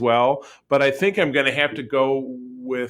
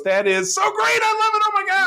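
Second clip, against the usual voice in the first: very high squealing delight; gushing Tendi. Retold or imitated. Tendi praises Peanut Hamper's chosen name, squealing high. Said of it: "Tandy goes".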